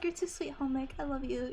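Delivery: ordinary talk, nothing marked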